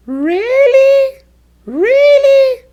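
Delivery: loud, very high rising "Really". Shown as a demonstration. Teacher demonstrating pitch changes meaning, very high voice. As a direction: squeal "Really" up at the top of the range. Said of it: "with a very high voice".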